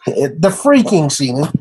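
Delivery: usual speaking voice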